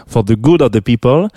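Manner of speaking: song title said in a careful English accent; a French host taking care with his English accent. Shown as a demonstration. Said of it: "j'essaye de faire attention à mon accent anglais".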